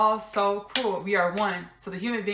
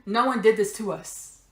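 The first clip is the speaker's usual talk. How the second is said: ordinary talk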